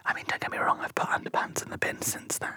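very soft, whispered aside; barely voiced admission; hushed confession. Real, not acted. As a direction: drop to a whisper and confide. Confiding an embarrassing aside.

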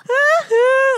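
high cackling laugh; witch cackle. Retold or imitated. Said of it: "like, this witch cackle".

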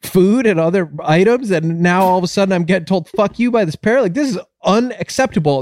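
high-pitched, acted customer outrage; very upset. Upset customer complaining to the pet store owner, high-pitched outrage. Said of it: "the guy gets very upset".

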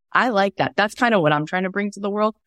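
ordinary talk

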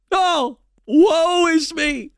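high, slow, hammed-up woe; sarcastic lament. Host mocking the chancellor's complaint, sarcastic wail. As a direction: wail it in fake grief, dripping sarcasm. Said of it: "do you all hear the sarcasm in my voice".

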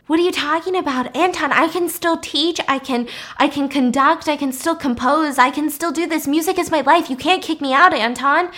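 high, urgent pleading; desperate protest. Retold or imitated. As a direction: act out a panicked plea to stay. Lizzie protests being kicked out.

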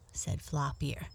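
usual speaking voice

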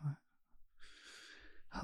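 breathy stunned whisper of disbelief; shocked whisper. Retold or imitated. Hearing the friend's news, a whispered shocked what. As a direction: barely breathe the word out.

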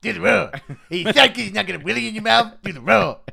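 loud, fast cartoon-kid chant through laughs; laughing kid impression; Shrek kid voice. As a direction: bellow like the Shrek toddler. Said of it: "that kid out of Shrek Three".

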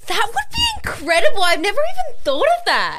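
high, loud burst of enthusiasm; excited gushing. Real, not acted. Musical fan hears a new idea, gushing excitedly. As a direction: gush over a brilliant idea.

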